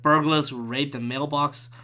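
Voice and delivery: ordinary talk, nothing marked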